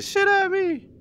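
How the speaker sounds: sudden high scared outburst; startled shriek. Real, not acted. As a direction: blurt it out after a real scare. Jolted by debris in VR.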